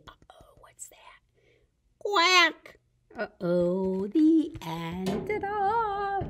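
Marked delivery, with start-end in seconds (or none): 0.1-1.2 whisper. Acted. Story twist, whispered. 2.0-2.8 duck quack. Acted. Duck sound at end.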